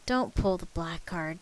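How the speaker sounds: usual speaking voice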